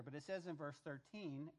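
ordinary talk, nothing marked

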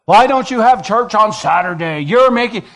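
loud, high challenging voice; a hammed-up challenger; mocking objector. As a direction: play the nagging critic. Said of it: "people come in here and say".

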